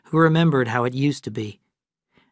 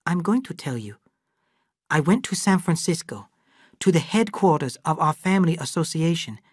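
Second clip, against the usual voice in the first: lightly raised voice of Lee, calm and knowing; Lee character voice; 6 semitones higher than usual. Acted. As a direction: Lee speaking matter-of-factly to the old friend. Lee tells his story.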